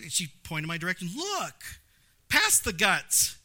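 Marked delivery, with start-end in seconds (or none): 1.2-3.4 wife pointing out view. Retold or imitated. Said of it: "And I was like".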